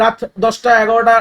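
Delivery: usual speaking voice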